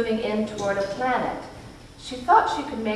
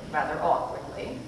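plain reading voice

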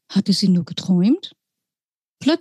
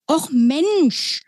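high, indignant mouse cry; 7 semitones higher than usual. Acted. Mouse pilot exclaims indignantly at Lilly. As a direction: exclaim it, offended. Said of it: "rief das Tier entrüstet".

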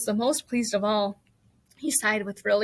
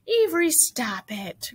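slow, higher-pitched scolding cry; cried out; 4 semitones higher, slower than usual. A narrator's reading. Mother to clowning Avery, slow scolding cry. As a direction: draw out the warning in a raised pitch. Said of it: "cried his mother".

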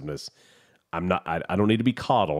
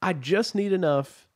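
high, careful voice spelling it out; pitched-up retold line; 10 semitones higher than usual. Caller retelling what he told the bank rep, high and patient. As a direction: quote yourself high and overly patient.

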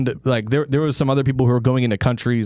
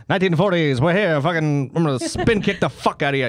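higher, mock forties Transatlantic showman; Transatlantic accent; 5 semitones higher than usual. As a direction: put on a cheeky Transatlantic entertainer voice. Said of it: "Atlantic accent".

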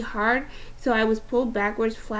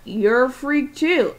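slow mocking Eddie; 3 semitones higher, 4 dB louder, slower than usual. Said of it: "He was like".

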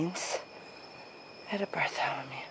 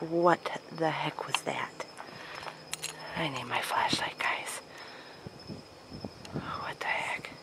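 scared whisper; 3 semitones lower, 10 dB quieter than usual. Real, not acted. Startled by noise.

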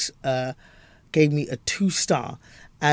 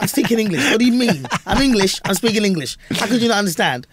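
higher, incredulous protest; mock exasperation; 5 semitones higher than usual. English comic answering Americans who didn't understand, exasperated. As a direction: insist in comic bewilderment.

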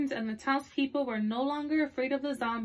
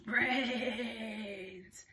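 zombie moan; 6 dB quieter than usual. Acted. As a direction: moan it like a hungry horde. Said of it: "they moaned".

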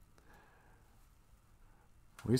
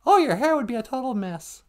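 high puppet-like voice; high-pitched comic exclamation; squeaky sidekick; 12 semitones higher than usual. Pretend. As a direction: chime in far above your own voice.

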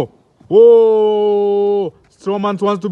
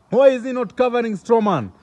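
ordinary talk

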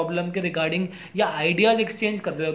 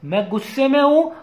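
demonstrating anger, louder and higher; raised angry voice; 5 semitones higher, 5 dB louder than usual. Shown as a demonstration. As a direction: raise pitch and volume to show anger. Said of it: "आवाज़ तेज करी". (गुस्से का नमूना, तेज ऊँची आवाज़)

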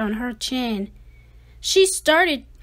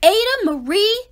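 louder, higher, rising call of her name; scolding call; 7 semitones higher, 7 dB louder, faster than usual. Acted. Parents scolding their daughter, a raised call.

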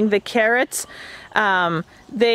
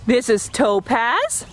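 usual speaking voice

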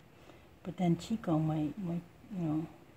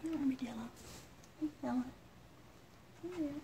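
cooing to an animal; 5 semitones higher, 9 dB quieter, faster than usual. Real, not acted. Calling softly to the armadillo.

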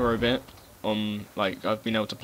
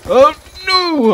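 real cry of dismay, much higher and louder; dismayed yell; 15 dB louder than usual. Real, not acted. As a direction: shout as the kill slips away. Dies in game, real dismay.

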